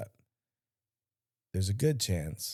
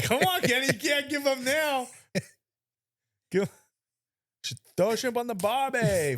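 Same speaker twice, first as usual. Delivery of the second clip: mock Australian accent; 13 semitones higher than usual. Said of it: "Australian accent".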